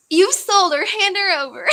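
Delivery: hammed-up grab for the dog; mock demand. Viewer jokingly demands the streamer's dog, loud mock demand. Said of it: "Someone said that about Lady".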